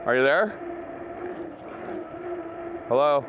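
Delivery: normal talking voice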